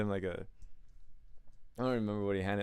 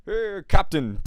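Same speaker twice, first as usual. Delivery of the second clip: mock greeting; 10 semitones higher, 11 dB louder, slower than usual. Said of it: "and he goes".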